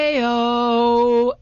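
a cheerful principal hollering hey-o; cheesy principal call. Retold or imitated. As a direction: belt it out like a peppy leader rallying a crowd. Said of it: "he goes".

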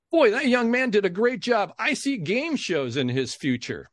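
showman's praise, starting high and slowing; imitated exclamation. Host praising a kid to the audience, mild showman's exclamation. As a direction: exclaim it to the room, then stretch it out. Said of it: "exclaim something like that".

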